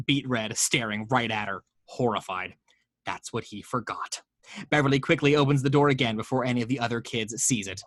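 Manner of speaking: ordinary delivery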